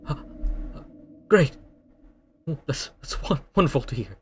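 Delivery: lightly voiced pleading prisoner; desperate prisoner. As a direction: beg to be freed, rising urgency.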